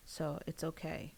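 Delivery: ordinary delivery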